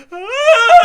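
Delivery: loud, high scream of panic, half laughing; laughing mock scream, loud and high; startled scream. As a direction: shriek like the floor is cracking. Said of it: "And he's like".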